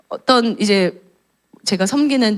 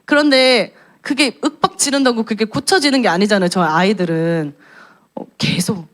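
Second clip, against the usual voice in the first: ordinary delivery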